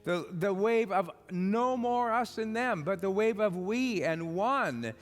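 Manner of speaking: loud, rousing sermon cry, each line punched home; rally the crowd to forgive, full voice; preaching at full force. Acted. Preacher rousing his congregation to act, loud and urgent.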